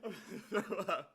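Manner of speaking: fast and laughing, out of control; real laughter rushing the words. Real, not acted. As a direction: gasp it out between laughs. Laughing so hard.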